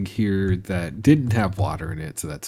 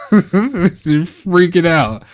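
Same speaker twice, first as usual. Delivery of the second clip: laughing; 9 semitones higher, 7 dB louder, much slower than usual. Real, not acted. Laughing at panicked cow.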